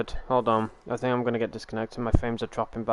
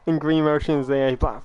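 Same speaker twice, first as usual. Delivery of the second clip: ordinary delivery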